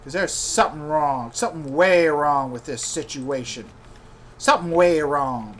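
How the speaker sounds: low, controlled anger; simmering, suspicious indignation; mad. Real, not acted. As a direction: insist on answers in a low, aggrieved voice. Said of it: "I'm mad".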